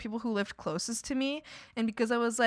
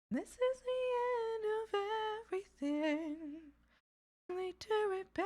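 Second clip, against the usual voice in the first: higher, far-off theatrical voice; a hammed-up prophet of doom, higher; mock doom prophet; 11 semitones higher, 7 dB quieter than usual. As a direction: intone the end of the world.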